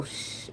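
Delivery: soft drawn-out shh; shushing. Retold or imitated. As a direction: hush someone with a long shh. Retelling a shushing.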